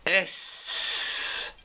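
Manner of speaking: "S" hissed softly, no voice; whispered S sound. Shown as a demonstration. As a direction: breathe out a soft unvoiced "S". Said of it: "que l'on prononce".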